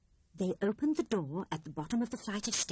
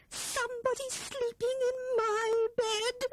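much higher, tearful frightened squirrel; frightened squeak. A narrator's reading. Squirrel tells her friends someone is in her bed, squeaking in fright. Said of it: "squeaked the squirrel".

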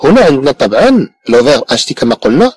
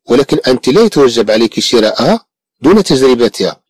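ordinary delivery

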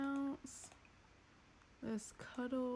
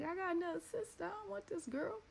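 put-on high, fast, whiny little boy; whiny kid voice; 9 semitones higher, faster than usual. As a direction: complain like a jealous big brother. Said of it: "He like".

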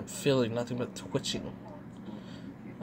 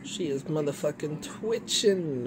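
normal talking voice

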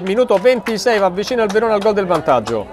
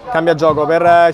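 ordinary talk

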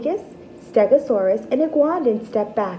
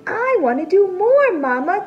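eager plea; 7 semitones higher than usual. Acted. T-Rex pleads again.

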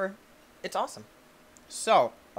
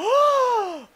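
mock gasp; 7 dB louder than usual. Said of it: "You guys are probably".

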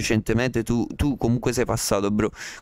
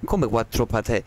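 ordinary delivery

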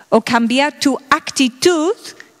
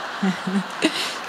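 breathy laugh; 7 semitones lower, 9 dB quieter than usual. Real, not acted. Laughs at own advice.

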